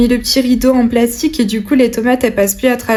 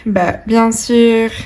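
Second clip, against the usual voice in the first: ordinary talk, nothing marked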